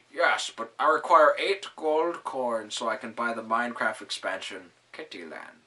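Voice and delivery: loud, huffy monster voice; Ryan monster voice. Acted. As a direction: grumble like an offended giant. Monster Ryan's voice in story.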